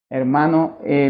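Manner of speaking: ordinary talk, nothing marked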